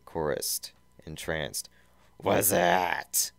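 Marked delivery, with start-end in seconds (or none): 2.2-3.3 Robotnik voice. Acted.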